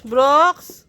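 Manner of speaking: demonstrated call, rising at the end. Shown as a demonstration. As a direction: call the name out for a child to copy. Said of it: "Sabi mo kay Brooks". (patawag na halimbawa para sa bata)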